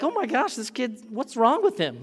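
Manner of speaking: high, loud astonished exclaim; amazed parents. Retold or imitated. Parents marvelling at their fearless toddler, high and astonished. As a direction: gasp at the strange kid.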